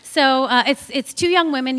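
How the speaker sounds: usual speaking voice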